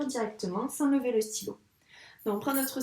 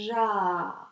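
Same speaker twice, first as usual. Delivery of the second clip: drawn-out ja sounded while writing; stretched syllable; 2 semitones lower than usual. Shown as a demonstration. Teacher to pupil, saying each sound while writing. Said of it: "j'ai bien dit tous les sons".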